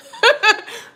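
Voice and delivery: real shrieking laugh; loud high laugh. Real, not acted. Laughs at a comment.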